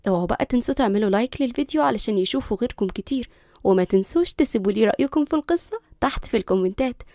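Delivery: ordinary delivery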